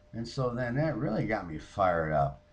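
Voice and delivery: ordinary delivery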